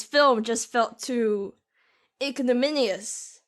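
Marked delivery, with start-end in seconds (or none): none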